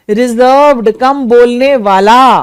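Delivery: ordinary talk, nothing marked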